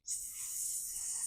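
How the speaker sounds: long voiceless hiss; snake hiss. Shown as a demonstration. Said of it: "let out a hissing sound".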